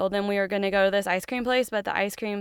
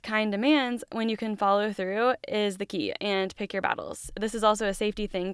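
ordinary delivery

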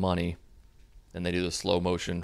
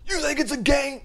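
high, raised mock rapper yell; imitated scream; 5 dB louder than usual. [name] confronting [name], high mock yell. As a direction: yell it high, holding a little back. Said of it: "just the way he screams".

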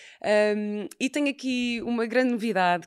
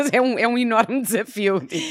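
laughing; 4 semitones higher, 10 dB louder than usual. Real, not acted. Laughing about talking with small kids.

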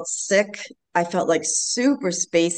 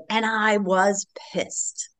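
slow, whispered demonic voice with intensity; demonic crazy voice; 2 semitones higher, 3 dB quieter, much slower than usual. Retold or imitated. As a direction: hiss it out slow like something possessed and primal. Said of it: "in this demonic, crazy voice with intensity".

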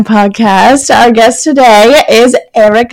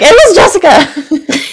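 laughing; 6 semitones higher than usual. Pretend. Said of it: "be like".

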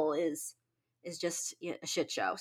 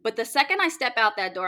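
ordinary talk, nothing marked

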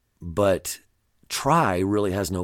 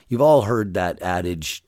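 normal talking voice

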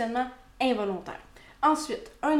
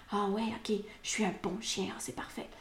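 softly voiced dog thinking to itself; dog's contented thought; 6 dB quieter than usual. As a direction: murmur the dog's reaction, trailing away very soft. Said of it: "Votre chien pourrait vous regarder". (pensée du chien, tout bas)

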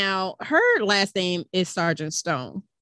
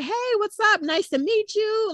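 higher, falsely casual hello; mock casual greeting; 13 semitones higher than usual. The sergeant pretending not to know his girlfriend, in a breezy raised voice. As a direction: greet her like a stranger, breezy. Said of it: "acting like he just came over and was like".

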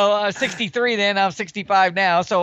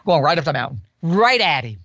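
ordinary talk

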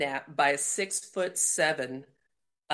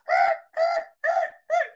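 very high, loud imitated tyre screeches; screeching cars; 5 dB louder than usual. Storyteller imitating cars stopping for her, repeated screech. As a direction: squeal like braking tyres. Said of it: "all these cars screech".

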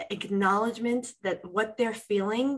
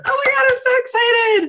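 put-on much higher, louder squealing little girl, quick; excited little girl; 8 dB louder, faster than usual. The inner child runs into the room, voiced as a squealing excited little girl. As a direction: burst in like a thrilled little girl. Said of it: "little girl that walks in and says".

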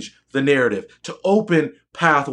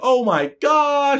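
mock gasp; 9 semitones higher, much slower than usual. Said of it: "everybody's like".

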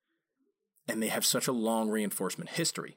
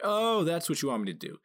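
dog's realization; 7 semitones higher, 3 dB louder than usual. Retold or imitated. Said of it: "where they're like".

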